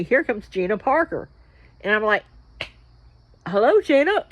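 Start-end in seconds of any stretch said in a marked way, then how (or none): 3.5-4.2 high, loud hello; excited greeting. Retold or imitated. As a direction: greet her with a bright raised hello. Greeting Gina in hallway.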